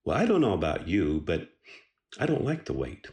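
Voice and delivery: normal talking voice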